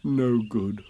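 subdued, mildly glum; sad. Acted. Pooh answering Rabbit's question, sadly. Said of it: "said Pooh sadly".

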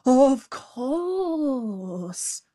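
high, drawn-out mock alarm; pretended fright. A narrator's reading. Jackal fooling the tiger, mock-frightened. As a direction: feign trembling agreement. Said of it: "pretending to tremble with fright".

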